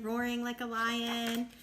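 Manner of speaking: ordinary delivery, nothing marked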